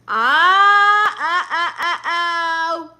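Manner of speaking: high, loud, drawn-out cry broken into bursts; loud wailing "ah". Real, not acted. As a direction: let out a long loud "ah", then stutter it. Exclaims at something on the livestream.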